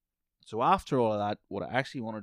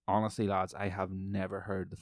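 ordinary delivery

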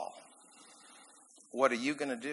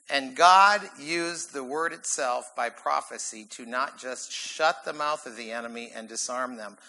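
normal talking voice

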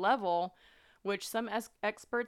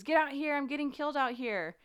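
high, loud mock scream; a shouted plea for help; retold scream; 8 semitones higher, 5 dB louder than usual. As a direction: yell it like you're being attacked. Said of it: "as he screams".

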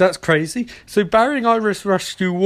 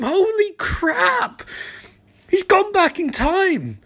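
slow, high amazed outburst; shocked; 11 semitones higher, slower than usual. Real, not acted. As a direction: draw out the disbelief. Shocked at twist.

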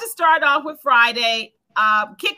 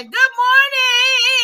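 high, slow, loud wake-up call; drawn-out shouted greeting; 9 semitones higher, 6 dB louder, much slower than usual. Acted. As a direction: sing out good morning to rouse her. Calling out greeting to sleepy cohost.

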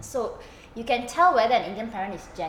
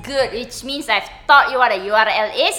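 a hint of an Indian teacher; lightly voiced strict teacher; Indian accent; 3 semitones higher, 9 dB louder than usual. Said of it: "How did that accent just immediately change".

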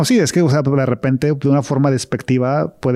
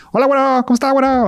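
imitating a taquero; 13 semitones higher, faster than usual. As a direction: greet a customer like a taco seller. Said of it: "con un taquero o alguna cosa así". (imitando a un taquero que llama al cliente)

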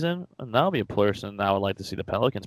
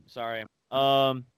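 ordinary talk

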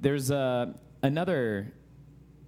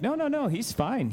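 nurse reassuring; 13 semitones higher than usual. Retold or imitated. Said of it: "the nurse is like".

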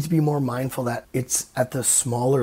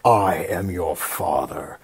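low, slow Vader delivery without Luke; Vader correct line; 6 semitones lower, slower than usual. Retold or imitated. Correct Vader line to Luke, low and slow. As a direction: lower and slower, solemn as Vader. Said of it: "But apparently it's not".

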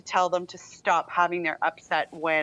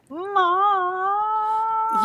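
high, drawn-out whiny "mom"; whined mom; 12 semitones higher, 3 dB louder than usual. Retold or imitated. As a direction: stretch "mom" up high like a child nagging. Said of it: "it goes on for so long".

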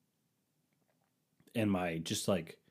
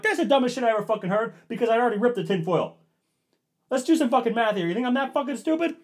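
much higher, louder, fast rant; retold yelling; 14 semitones higher, 8 dB louder, faster than usual. Husband mocking wife's excuse, loud fast retold anger. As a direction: tear into her. Retold argument, his line.

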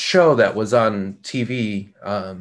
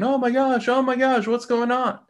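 much higher, mock-panicked cry; panicked owners; 12 semitones higher than usual. Dog owners panicking at the aggressive dog, high frantic voice. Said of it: "freaking out and crying and saying".

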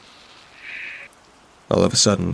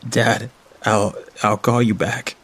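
tearful; 2 semitones higher than usual. A narrator's reading. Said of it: "tears filling in my eyes".

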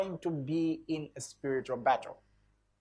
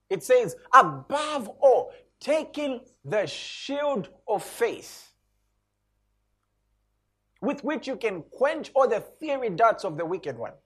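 "Above all" slow, high and loud, then drawn on; emphatic scripture; 7 semitones higher, 3 dB louder, slower than usual. Shown as a demonstration. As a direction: preach it with full weight. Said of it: "special emphasis on this".

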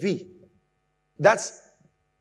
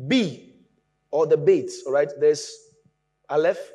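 usual speaking voice

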